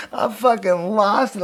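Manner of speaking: ordinary talk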